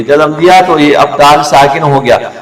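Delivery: ordinary talk, nothing marked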